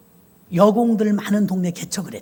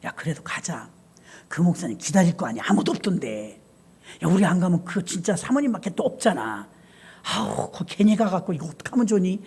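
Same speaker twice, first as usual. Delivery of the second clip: put-on hushed girls' talk; 6 semitones lower, 5 dB quieter than usual. Girls deciding quietly.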